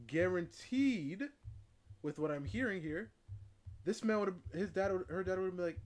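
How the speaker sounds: loud, emphatic insistence; forceful loud conviction; heated rant. Real, not acted. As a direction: hammer the point home loudly. Heated rant about family.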